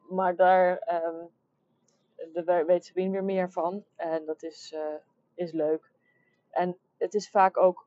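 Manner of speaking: ordinary delivery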